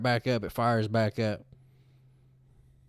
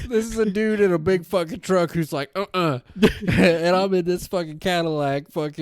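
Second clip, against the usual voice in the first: laughing while telling; 8 semitones higher, 7 dB louder than usual. Real, not acted. Laughing through the retelling.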